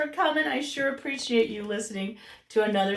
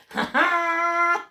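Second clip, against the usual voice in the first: triumphant Jack; 6 semitones higher, 5 dB louder than usual. Retold or imitated. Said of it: "he's like".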